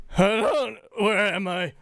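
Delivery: high and bewildered; a lost newborn object; confused little voice. Acted. GM voices bag waking up.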